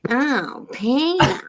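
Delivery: a light Lois Griffin voice, rising on Pina; a hint of Lois calling for Peter; Lois from Family Guy. A mom mimicking Lois from Family Guy, lightly, rising on the name. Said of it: "It sounded just like Lois".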